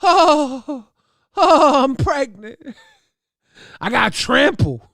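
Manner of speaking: high, loud, slow put-on wail; hammed-up high pleading woman; mock pleading woman. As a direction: beg for mercy in a whiny voice.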